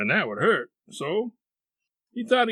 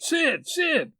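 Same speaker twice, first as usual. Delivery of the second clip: high, urgent call to wake someone; exasperated high-pitched wake-up call; aggravated calling; 4 dB louder than usual. A narrator's reading. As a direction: call the name twice, pitched right up. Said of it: "Tom was aggravated".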